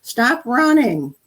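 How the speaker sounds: mock yell. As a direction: call out to a running child, stretching every word. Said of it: "instead of yelling across the room".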